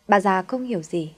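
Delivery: plain reading voice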